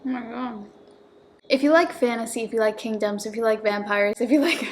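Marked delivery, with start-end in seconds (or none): 0.1-0.6 mouth full. Real, not acted. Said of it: "while I eat this".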